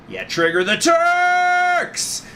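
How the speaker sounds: slow, high, loud rally cry; crowd shout. Acted. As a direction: yell it out from the crowd.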